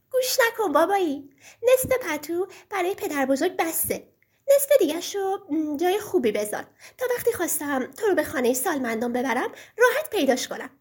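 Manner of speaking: put-on high, innocent little girl; sweet child voice. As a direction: speak as a small daughter, naively. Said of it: "صدای شیرینی بلند شد".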